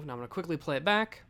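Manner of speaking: ordinary talk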